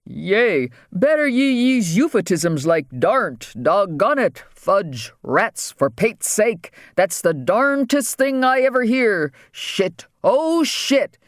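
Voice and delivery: a hint of a salty pirate; pirate talk. Press secretary talking like a pirate at the table, mild pirate voice. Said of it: "speaking like a pirate".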